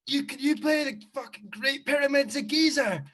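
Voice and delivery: loud shout. Retold or imitated. Drunk request retold loudly.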